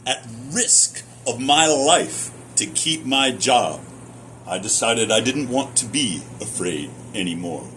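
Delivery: usual speaking voice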